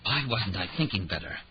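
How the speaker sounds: ordinary delivery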